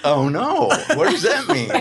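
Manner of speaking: high laughing "oh no"; laughing panic. Retold or imitated. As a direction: squeal it out through a laugh. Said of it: "and I'm like".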